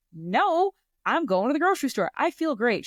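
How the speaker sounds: put-on high, quick, insistent, like a kid who refuses to be left home; eager protest. To mom at recovery, insisting on the grocery trip: high, fast protest. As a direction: rush it out, bright and high, brushing off the worry.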